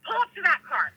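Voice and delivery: lightly voiced bossy grandmother; fiery grandmother. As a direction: order the driver as a feisty granny. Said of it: "this is my grandmother".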